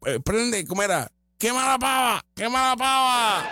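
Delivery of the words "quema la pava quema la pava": higher exclaimed chant; mock rally cry. Imitating her attempt at a party chant, higher. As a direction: cry it out like a crowd-pleaser. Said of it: "Por poco dice hasta refrán mal".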